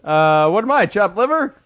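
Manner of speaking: loud, high dad-joke quip; old borscht belt comic. Retold or imitated. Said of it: "Anchor's like".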